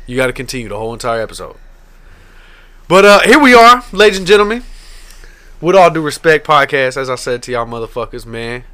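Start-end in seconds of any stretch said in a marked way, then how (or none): 2.9-7.0 loud, high showman announcement; host intro. Acted. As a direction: announce the show like a ringmaster. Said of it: "podcast host intro".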